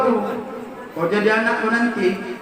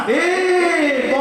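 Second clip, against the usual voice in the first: Batak angry outburst; 6 semitones higher than usual. Retold or imitated. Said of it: "marah sama orang".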